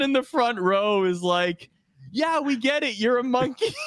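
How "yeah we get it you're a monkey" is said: a lightly voiced crowd heckler, pitched up; mock heckler. Front-row fan mocking the ring announcer, pitched up.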